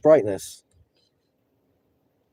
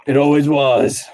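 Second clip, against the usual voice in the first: meme astronaut; 3 dB louder than usual. Retold or imitated. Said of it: "astronaut with a gun behind him".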